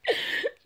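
breathless high cackle; real delighted laugh; high squeal laugh. Real, not acted. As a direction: burst out in a shrieking laugh. Reacting to caveman line.

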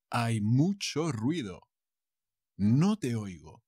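measured teaching delivery, falling at the end; careful model sentence. Shown as a demonstration. Teacher models the phrase for learners, carefully measured. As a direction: say it deliberately for learners.

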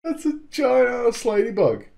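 usual speaking voice

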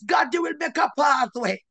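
usual speaking voice

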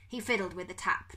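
plain reading voice

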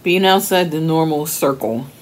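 talking between spoonfuls of soup; slurred by liquid in mouth; mouth full of soup. Real, not acted. Seen 1: Spoon at lips, then held near mouth while speaking between sips.

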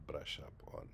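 softly whispered recap, no voice. Real, not acted. Teacher recapping the phrase to himself, whispered. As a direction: breathe the phrase under your breath.

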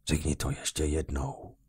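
soft, menacing whisper; hushed and cold; whispered threat. A narrator's reading. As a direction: breathe it quietly, dangerous. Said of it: "zašeptal nově příchozí".

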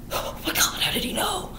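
whispered gasp of alarm; shocked whisper. Retold or imitated. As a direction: breathe it out in a panicked whisper. Sweeney shocked at being found out.

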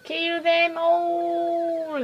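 rising, drawn-out war cry; battle cry. Acted.

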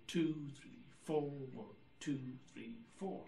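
two and four punched; accents on two and four. Shown as a demonstration. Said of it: "We feel it as one".

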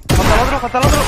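loud, high shout to teammates; urgent callout. Real, not acted. Urgent callout to teammates mid-fight. (aviso urgente a gritos)

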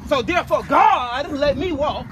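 lightly hammed-up sermon voice; mock preaching. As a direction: preach it like a pastor, half joking. Preaches to self while walking.

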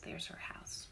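hushed aside; whisper. Real, not acted. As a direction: whisper it to the listeners. Narrator aside to kids.

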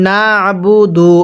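slow না'বুদু, squeezed after না; pressed ayn. Shown as a demonstration. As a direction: press the throat sound in না'বুদু on a level tone. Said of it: "হ্যাঁ পড়ুন".

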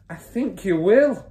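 high, slow, emphatic confirmation; mother's voice. A narrator's reading. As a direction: break it to him as the wife. Mother confirms the bad news.

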